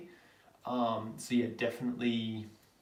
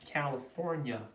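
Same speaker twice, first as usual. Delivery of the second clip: put-on Californian American; playful mock American accent. Shown as a demonstration. Streamer plays American saying hometown, American accent. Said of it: "my Ameri-, where, where are you from in America".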